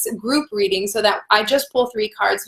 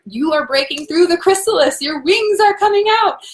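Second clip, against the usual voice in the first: higher, elated cheering-on, breaking into laughter; excited encouragement; 6 semitones higher than usual. Real, not acted. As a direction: lift the voice high to celebrate their breakthrough. Cheering viewers on about breakthrough.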